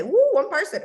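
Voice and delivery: loud, high whoop; excited cheer. Pretend. Logging in to cheer about one new person, excited. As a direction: celebrate like it's a big win.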